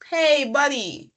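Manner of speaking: cheery call to a friend; casual buddy greeting. Shown as a demonstration. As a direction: greet an old pal, easygoing.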